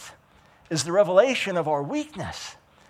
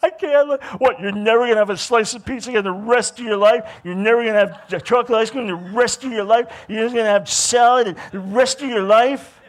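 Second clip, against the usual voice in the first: high, loud, desperate protest at the thought of no pizza; half-laughing panic, voice pitched up; frantic inner complaint, higher and louder; panicked inner voice; 4 semitones higher, 7 dB louder than usual. Retold or imitated. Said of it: "I remember thinking".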